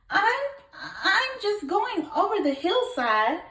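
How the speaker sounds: high, stammering small goat voice; stammering little goat. Acted. Little goat stammering nervously in reply to the troll. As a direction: stutter it out, scared of the troll. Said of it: "the goat replied".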